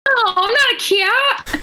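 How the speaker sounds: squeaky high protest; mock high voice. Mock protest at being sprayed.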